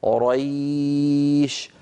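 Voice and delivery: قريش held very long on the ay; long lin glide. Shown as a demonstration. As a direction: stretch the ya of قريش slowly. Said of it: "لما أقول أنا". (مد الياء في قريش طويلا)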